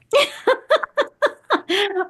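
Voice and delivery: long high peal of laughter. Real, not acted. Host teasing guest who's not there yet, high laugh.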